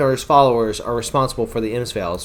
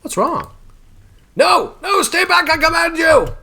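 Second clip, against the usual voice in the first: high, loud panicked shouting; cornered wizard screaming commands; 4 dB louder than usual. Acted. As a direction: yell in terror as the golem attacks. Wizard's golem turns on him.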